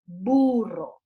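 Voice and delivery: "burro" lento y grave, alargando la primera sílaba; dictado articulado. Retold or imitated. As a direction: imita a la maestra que dicta "burro" marcando la B. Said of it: "le miráramos la boca. Entonces ella decía". ("burro" dictado despacio, con articulación exagerada)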